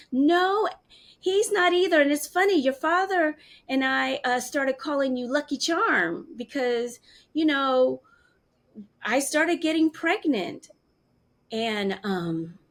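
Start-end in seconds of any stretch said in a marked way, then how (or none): 0.1-10.7 higher, louder, bright imitated mom; cheerful mom. Daughter imitating mom cheerfully telling of the pregnancy, high. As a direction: play mom lightly amused by the story.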